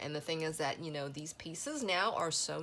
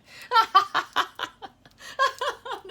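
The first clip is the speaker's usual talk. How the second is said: loud high laugh; high burst of laughter; 11 dB louder than usual. Real, not acted. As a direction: break into laughter. Laughing at herself.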